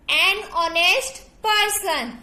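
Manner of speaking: Honest mein h silent, "ऑनेस्ट" jaisa; "honest" bina h ke, "ऑनेस्ट" bolo. Shown as a demonstration. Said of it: "हमें H silent है यहाँ पे". ("honest" को "ऑनेस्ट" बोलें, H silent)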